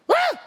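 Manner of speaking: high, loud panicked yelp; startled yelp. Retold or imitated. College kid fleeing the party, high startled yelp. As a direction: shriek and bolt away. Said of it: "So he just went".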